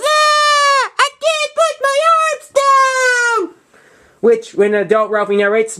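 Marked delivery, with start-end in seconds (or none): 0.0-3.6 very high, slow, hammed-up whine; high, drawn-out childish whine about the snowsuit; whining little brother. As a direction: whine it like a bundled-up little kid. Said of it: "he whines".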